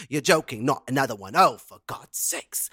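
a lightly voiced, weary British grumble; imitating Brenda from Bristol. Quoting Brenda from Bristol in a weary grumble. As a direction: mimic the fed-up woman from the news clip. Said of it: "Brenda from Bristol".

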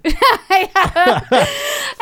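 loud cackling laugh; high burst of laughter. Real, not acted. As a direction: let out a high whooping laugh. Big laugh at the joke.

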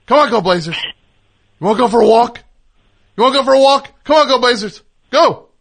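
shouting through speakerphone at dogs; loud excited calls to the dog. Real, not acted. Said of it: "let's see if we can get these dogs barking".

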